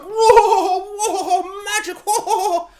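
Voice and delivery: high, loud, laughing gasp of awe; a muggle gaping at magic, half laughing; Jacob's wonder. Retold or imitated. Muggle Jacob gawping at magic, high laughing awe. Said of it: "he's just like".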